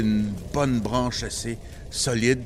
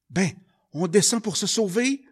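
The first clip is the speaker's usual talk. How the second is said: high, loud anxious answer; urgent boy; 12 semitones higher, 7 dB louder than usual. Acted. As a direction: blurt the escape plan up high. (garçon pressé, voix haute et forte)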